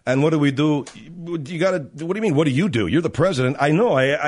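usual speaking voice

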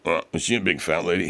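put-on creepy killer voice; Buffalo Bill voice. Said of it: "the perfect Buffalo Bill impression".

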